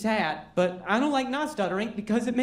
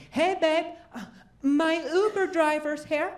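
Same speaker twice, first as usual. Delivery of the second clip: higher-pitched call to the next room; 8 semitones higher than usual. Acted. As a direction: casually call out to someone next door. Calls to wife in next room.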